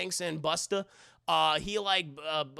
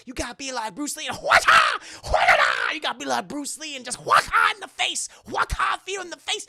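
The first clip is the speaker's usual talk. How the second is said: hyped kung fu shout; 8 semitones higher, 8 dB louder than usual. Retold or imitated. [name] yells kung fu cry.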